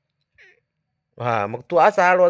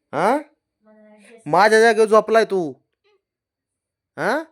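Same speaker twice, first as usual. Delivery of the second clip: louder, higher complaint; complaining; 9 semitones higher, 6 dB louder than usual. Real, not acted. Owner scolding the dog for her spot, loud and high. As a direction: protest loudly to the dog.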